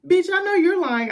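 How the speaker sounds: high, loud, incredulous outburst; outraged yell. Retold or imitated. Venting at Jamie on TV, high loud yell. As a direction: shout it like you can't believe his nerve.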